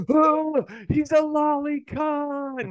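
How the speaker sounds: very high, drawn-out mock dismay; hammed-up falsetto alarm; mock horror. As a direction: imitate a scandalized squeal. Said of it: "he's like".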